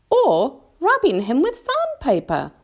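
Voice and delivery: high, lilting creature voice; ladybird suggesting. A narrator's reading. Ladybird suggests sandpaper for the centipede, in a high voice. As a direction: chip in with a helpful idea.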